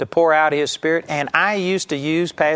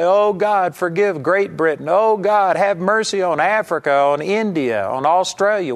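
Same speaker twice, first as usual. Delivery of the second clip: acted-out intercessory begging; mock pleading; 4 semitones higher than usual. Preacher showing how people are taught to pray, mock pleading. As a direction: imitate an urgent plea for mercy, lifting on each "Oh God". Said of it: "you have to plead and say".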